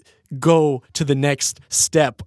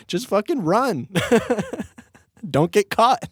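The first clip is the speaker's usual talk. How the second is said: higher, through bubbling laughter; laughing advice; 9 semitones higher than usual. Real, not acted. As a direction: crack up while giving advice. Laughs at own bad advice.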